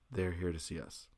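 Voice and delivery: low, soft admission; grim deadpan. Retold or imitated. Musician admits whom the Nazis came for, grim deadpan. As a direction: drop it quietly, resigned.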